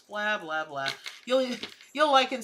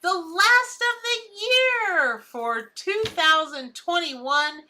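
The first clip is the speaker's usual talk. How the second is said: high, bright announcer greeting, slow; show host intro; 9 semitones higher, 7 dB louder, slower than usual. Acted. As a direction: open the show big and sing-song.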